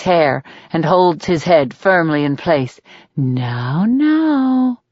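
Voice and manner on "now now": rising, mock-gentle scold; a hint of a scolding nurse. A narrator's reading. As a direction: scold him like a naughty child. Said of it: "she scolds".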